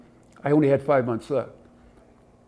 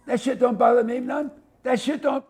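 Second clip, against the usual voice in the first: mock tough cop; 9 semitones higher than usual. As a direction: push the brag up a notch. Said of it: "And he says".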